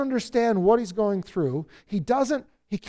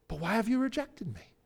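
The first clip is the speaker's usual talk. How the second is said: softer, hurt question; abandoned; 7 dB quieter than usual. Acted. Psalmist feels abandoned by God, soft hurt question. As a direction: let it drop to a small plea. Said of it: "He feels abandoned by God".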